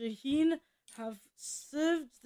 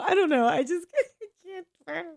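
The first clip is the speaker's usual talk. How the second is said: half laughing, half crying, pitched up; real nervous laugh breaking the line; laughing helplessly; 7 semitones higher, 5 dB louder than usual. Real, not acted. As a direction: laugh through the words like you're about to crack. Laughing at own anxiety.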